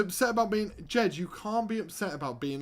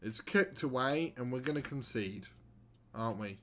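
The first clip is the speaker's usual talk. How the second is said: usual speaking voice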